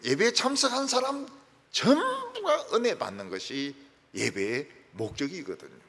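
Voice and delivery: ordinary talk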